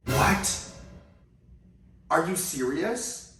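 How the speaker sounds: imitating a stunned mom, loud then drawn out; retold disbelief, pitch climbing high on the question; shocked mom. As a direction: act out mom's jaw-dropped shock. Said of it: "she was just shocked".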